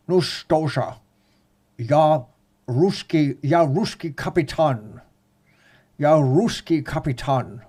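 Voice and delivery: slow, hammed-up movie Russian accent; mock Russian sea captain, slow movie accent; Sean Connery's Russian. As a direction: draw it out like bad film Russian. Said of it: "speak Russian like Sean Connery".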